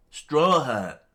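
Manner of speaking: high, very loud exclamation; startled shout. Acted. Onlookers spotting Luffy by the flag, startled shout. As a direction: holler it up at him.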